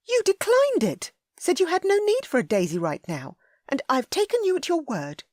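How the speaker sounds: high, loud indignant lady; Ellie, furious. A narrator's reading. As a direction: cut him off in a rage. Ellie reproachful.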